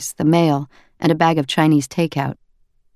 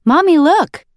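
put-on high, loud excited little boy; child calling out; 12 semitones higher, 6 dB louder than usual. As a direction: call out to mom like a toddler. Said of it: "Will called out".

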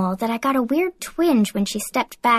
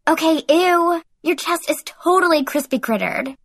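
put-on higher, disgusted valley-girl tone; grossed out; 6 semitones higher than usual. A narrator's reading. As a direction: recoil in mock disgust. Aphrodite's disgust.

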